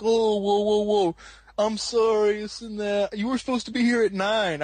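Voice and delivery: high, loud mock backpedalling; defensive. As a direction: imitate someone hastily on the defensive. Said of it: "defensive mode".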